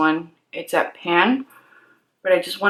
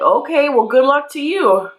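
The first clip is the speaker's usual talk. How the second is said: snarky attendant; 7 semitones higher than usual. Retold or imitated. Attendant voiced dismissively.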